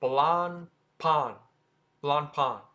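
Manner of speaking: Blancpain as blahn-pahn, silent C; French pronunciation. Shown as a demonstration. Teacher models the French way, French pronunciation. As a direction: drop the C in Blancpain. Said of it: "pronouncing it the French way".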